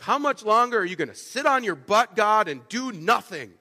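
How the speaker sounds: impatient demand that God finally act; accusatory. Retold or imitated. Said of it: "an accusatory "How long?".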